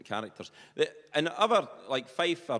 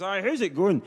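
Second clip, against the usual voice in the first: loud, high Perthshire farmer addressing a computer; broad Perthshire, raised voice; Willie; 6 semitones higher, 6 dB louder than usual. Retold or imitated. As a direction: hail the machine like a person, loudly. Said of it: "as if he was, eh, speaking to the computer".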